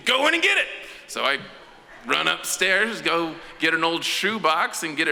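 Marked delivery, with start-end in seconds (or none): 0.1-0.7 high, loud bossy order. Retold or imitated.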